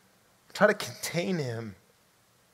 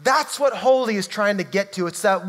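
ordinary talk

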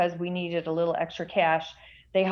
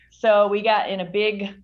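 ordinary talk, nothing marked

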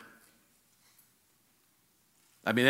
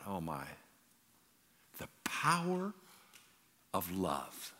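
softer, awed inner thought; hushed awe; 4 semitones lower, 10 dB quieter, slower than usual. Retold or imitated. Preacher recalling seeing his dad cry, hushed in awe. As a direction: breathe it out in wonder.

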